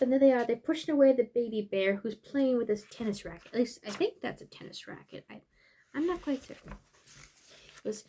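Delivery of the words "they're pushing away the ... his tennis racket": plain reading voice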